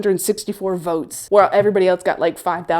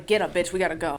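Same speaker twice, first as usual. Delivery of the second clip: ordinary delivery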